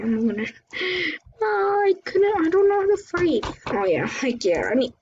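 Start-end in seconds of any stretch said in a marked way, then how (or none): 1.4-3.5 panicked. Real, not acted. Panic during the fight.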